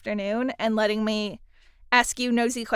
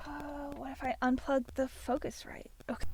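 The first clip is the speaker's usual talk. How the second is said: softly whispered question to herself; 2 semitones higher, 12 dB quieter than usual. Real, not acted. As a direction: wonder aloud in a whisper. Muttering while fixing mic.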